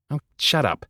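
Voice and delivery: high, sheepish retort; embarrassed. A narrator's reading. Boy flustered by Hazel's teasing, sheepish. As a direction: brush it off, flustered.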